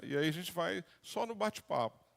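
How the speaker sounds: ordinary talk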